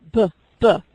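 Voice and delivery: "b" sounded out alone; isolated first sound. Shown as a demonstration. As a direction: say "B, b" as a lone b sound. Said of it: "what's the first sound in Billy?".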